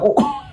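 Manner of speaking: a very high squeal that tips into laughter; shriek into laugh. Retold or imitated. Friends stuck in the car shriek, then laugh. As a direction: shriek in exasperation, then crack up.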